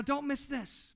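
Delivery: usual speaking voice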